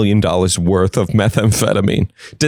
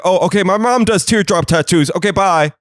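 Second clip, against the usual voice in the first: higher, hurried awkward goodbye; a lightly voiced flustered man backing out; flustered trafficker; 8 semitones higher than usual. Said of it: "He's like".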